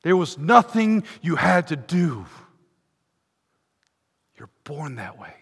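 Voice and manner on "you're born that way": hushed, sudden whisper. Acted. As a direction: drop to a whisper to make them lean in. Dropped aside after loud preaching.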